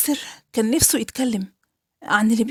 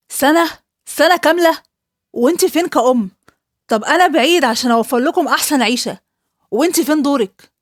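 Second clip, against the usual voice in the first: raised-voice furious father; angry accusing father; 2 semitones higher, 4 dB louder than usual. Acted. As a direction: blame her for not noticing. Father blames mother angrily.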